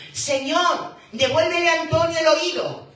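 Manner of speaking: loud, high pleading prayer; crying out in prayer. Acted. Congregation pleading with the Lord for Antonio's hearing, loud cry. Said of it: "seguimos clamando".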